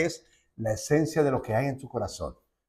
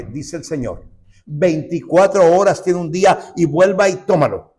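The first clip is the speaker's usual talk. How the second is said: slow, louder and higher, hammering the word batalla; preaching at full force; 6 semitones higher, 8 dB louder than usual. Acted. Preacher warning the church that the battle is real, at full force. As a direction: rouse the congregation like a revival preacher.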